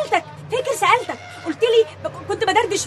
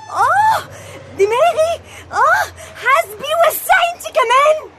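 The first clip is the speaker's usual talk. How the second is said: crying in pain; 7 semitones higher, much slower than usual. Acted. Hurt woman pushing another away, crying in pain. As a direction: cry out, hurt and irritable.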